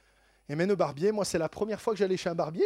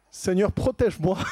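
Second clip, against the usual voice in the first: louder, laughing through the words; laughing prayer; 7 dB louder than usual. Real, not acted. Telling the barber story, laughing as he prays for protection. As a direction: pray while cracking up. Razor close to his neck.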